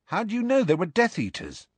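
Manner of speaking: high, quick youngster's question; questioning boy. Acted. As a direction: fire off the question, high-voiced. Ron questions Hagrid.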